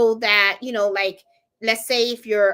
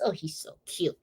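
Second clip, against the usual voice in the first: soft whispered aside; hushed, fond whisper; 5 semitones lower, 7 dB quieter than usual. Real, not acted. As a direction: whisper how cute he is. Whispered aside about dog.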